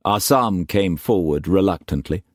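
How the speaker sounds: ordinary delivery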